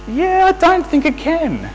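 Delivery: high, doubtful "yeah" that dismisses the claim; sceptical. Acted. Customer doubts the sales assistant, high sceptical tone. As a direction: talk down a clueless salesman.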